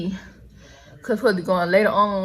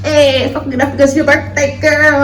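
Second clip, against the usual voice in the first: ratchet greeting; 5 semitones higher, 6 dB louder than usual. Retold or imitated. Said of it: "And I'm like".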